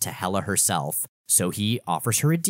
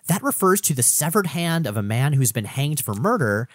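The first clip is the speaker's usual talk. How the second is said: normal talking voice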